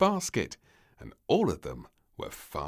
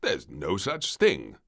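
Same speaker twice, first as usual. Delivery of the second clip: slow, firm and louder; firmly; 7 dB louder, slower than usual. Acted. King answering the scared maid, firm and slow. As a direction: put the fear down firmly. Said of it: "the king said firmly".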